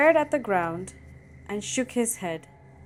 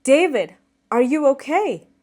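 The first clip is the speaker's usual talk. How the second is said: put-on loud, raised-pitch call to a child; urgent fatherly shout, higher and louder; alarmed shout; 5 semitones higher, 8 dB louder than usual. A narrator's reading. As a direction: shout in worry for your son. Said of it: "I shouted".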